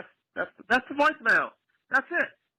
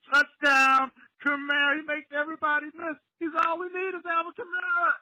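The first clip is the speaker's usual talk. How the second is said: excited call; 9 semitones higher than usual. Acted. Fan mimics the broadcast calling Kamara's touchdown, excited. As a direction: call it like a radio announcer.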